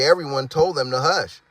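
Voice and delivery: usual speaking voice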